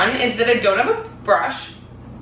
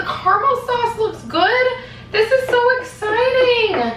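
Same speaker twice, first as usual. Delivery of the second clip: excited; 11 semitones higher, slower than usual. Real, not acted. Thrilled over her caramel sauce.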